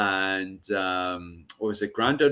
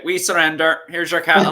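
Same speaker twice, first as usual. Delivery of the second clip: mock surrender; 5 semitones higher, 5 dB louder than usual.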